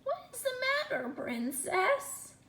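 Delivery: high, fast little frog voice; timid scratchy frog. A narrator's reading. Frog calling to the princess, high and quick. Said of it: "a timid, scratchy voice behind her say".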